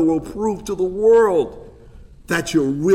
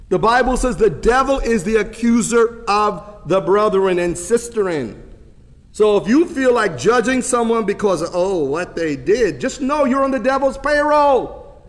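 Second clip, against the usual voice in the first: usual speaking voice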